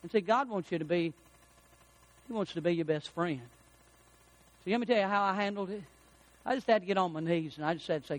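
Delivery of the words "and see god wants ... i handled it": normal talking voice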